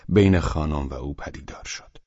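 plain reading voice

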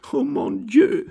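very high, anguished moan. A narrator's reading. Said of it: "stöhnte die arme junge Frau".

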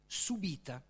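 ordinary delivery

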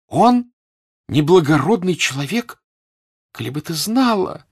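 high, loud incredulous echo; affronted, high-pitched exclamation; indignant disbelief. A narrator's reading. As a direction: throw the accusation back, outraged. Natasha indignantly echoes the doubt.

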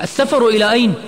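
high, loud, alarmed question; shouting. A narrator's reading. Friend jumping up at news of travel, shouting. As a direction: cry out the question in disbelief. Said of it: "نهض عماد من مكانه وهو يصيح".

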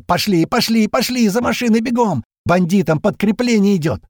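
high, loud urgent shout over the radio. A narrator's reading. Voice on the radio urges them to run, loud high shout. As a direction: bark the order to run for the cars. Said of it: "этот голос в рации закричал".

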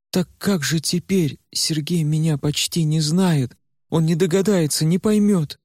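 put-on high, desperate girl's voice; Варя in despair. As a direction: ask in rising panic. Varya frightened. (высоким, отчаянным голосом Вари)

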